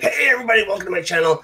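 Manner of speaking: high, loud cheesy channel greeting; YouTuber intro voice. Retold or imitated. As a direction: overdo the generic vlogger welcome. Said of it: "having me be like".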